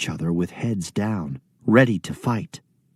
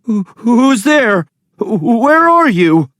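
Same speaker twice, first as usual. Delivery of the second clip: high, loud frightened calling; voice shaking; 11 semitones higher, 8 dB louder than usual. Acted. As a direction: call out scared into the dark. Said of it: "voice shaking".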